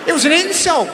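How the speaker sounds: high, loud outburst; emphatic rant. Real, not acted. Comic telling the crowd, high loud outburst. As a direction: yell it like an insult. Ranting about cold piss.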